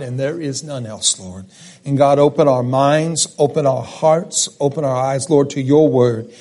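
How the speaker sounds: low, hushed prayer voice. Acted. Pastor leads the opening prayer.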